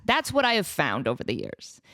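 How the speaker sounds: ordinary talk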